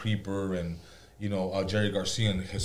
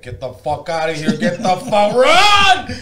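rising panicked yell breaking into a laugh; frantic get-me-out-of-here yell, louder and higher; panicked inner voice; 7 semitones higher, 10 dB louder, slower than usual. Retold or imitated. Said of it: "my brain's like".

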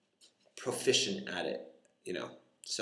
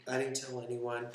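ordinary talk, nothing marked

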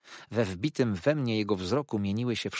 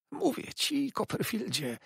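much higher, sly hiss in the ear; hissing toady. A narrator's reading. Uriah hisses at Copperfield's ear, high and sly. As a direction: hiss it close like a clinging clerk. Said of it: "syknął mi nad uchem".

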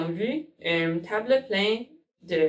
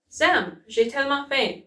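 mild cry out; 6 semitones higher than usual. A narrator's reading. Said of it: "cria-t-elle".

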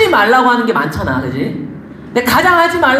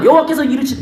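normal talking voice

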